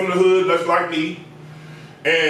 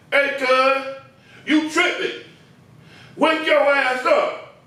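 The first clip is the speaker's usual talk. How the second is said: higher, slow mock-annoyed scolding; imitating a pissed-off client; 5 semitones higher, slower than usual. Client turns on the nodding barber, mock angry scolding. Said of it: "was like".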